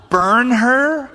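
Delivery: high, loud incredulous echo. Real, not acted. Preacher echoing Judah's verdict in disbelief, incredulous.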